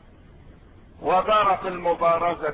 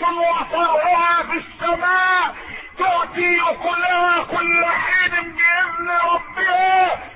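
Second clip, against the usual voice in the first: full-force preaching; 9 semitones higher than usual. Real, not acted. Preacher proclaims the creed above world powers, soaring impassioned preaching. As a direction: build to a long, ringing proclamation.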